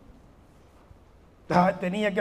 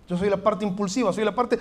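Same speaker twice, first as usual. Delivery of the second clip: usual speaking voice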